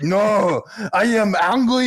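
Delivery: high, loud, frustrated mock French; hammed-up French exasperation; thick French accent. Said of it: "he was thick with it".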